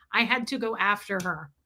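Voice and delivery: ordinary talk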